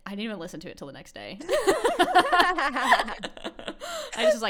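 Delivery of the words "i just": laughing. Real, not acted. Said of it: "That is funny".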